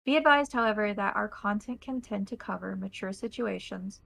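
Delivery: loud, formal show opening; announcer intro. Acted. Host welcoming the audience to the table, formal announcer read.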